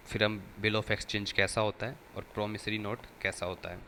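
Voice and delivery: ordinary talk, nothing marked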